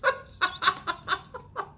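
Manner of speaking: high giggle; real amused laugh. Real, not acted. Reader laughing at her own story, giggling.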